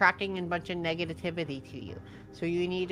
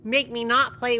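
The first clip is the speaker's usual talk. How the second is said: mock angry; 4 semitones higher, 8 dB louder than usual. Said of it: "You're making me angry".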